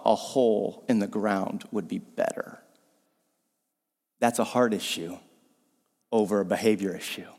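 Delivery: soft, low, slowed confession to the congregation; hushed earnest preaching. Acted. Preacher softly confessing a heart issue before God. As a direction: lean in and drop it to a near-hush.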